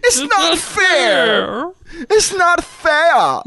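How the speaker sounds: mock his complaint like a sulky villain; mocking whine. Friend mocks Todd's complaint, drawn-out high whine. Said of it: "you just said".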